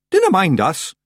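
loud, high Scots character voice; Scottish Feegle. A narrator's reading. Rob Anybody pipes up from the bristles, Scottish. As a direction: pipe up in broad Scots.

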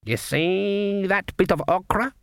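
high, slow, drawn-out seer's voice; psychic growl. A narrator's reading. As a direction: stretch the words like a fortune-teller reading signs. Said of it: "dropped into the rolling growl of the psychically gifted".

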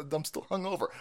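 normal talking voice

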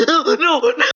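high protest through laughter; laughing protest. Real, not acted. Friend reacting to the video, laughing helplessly. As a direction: plead through helpless giggles.

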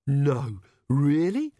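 a touch of shocked disbelief. A narrator's reading. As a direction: Agnes reacts to the dead parts, lightly shocked. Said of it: "said Agnes, shocked".

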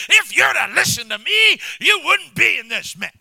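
loud, high scolding shout; angry. Retold or imitated. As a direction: tell them off loudly. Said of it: "He gets mad and says".

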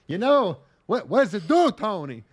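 put-on failed Italian-American accent, slow and halting; put-on mobster with big rise-and-fall swoops; Christopher Walken. Mafia guy asking Tony about drugs, accidental celebrity-like halting voice. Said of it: "without sounding like Christopher".

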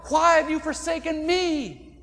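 a cry of pain, slowed on the question; cry of abandonment. Retold or imitated. Preacher voices Jesus on the cross, a loud anguished plea. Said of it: "utterly abandoned".

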